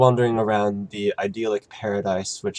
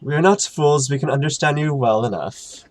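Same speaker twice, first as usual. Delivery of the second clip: loud, high angelic rebuke; proud voice of an offended angel; booming angel voice; 6 semitones higher, 6 dB louder, faster than usual. Acted. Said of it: "Gadriel says".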